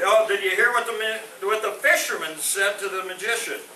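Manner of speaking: ordinary talk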